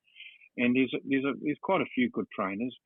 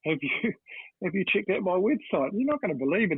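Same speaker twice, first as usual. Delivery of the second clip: eager salesman; 8 semitones higher than usual. Retold or imitated. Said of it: "He said".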